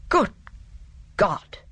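stagey dismay, pausing between the words; theatrical dismay. A narrator's reading. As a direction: react with actorly shock, word by word. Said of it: "registered a more than life-size dismay".

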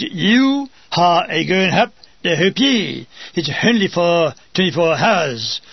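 Sergeant King. Retold or imitated. Sergeant giving the narrator a posting, slow and gruff. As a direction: hand down orders like a blunt sergeant. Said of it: "He says".

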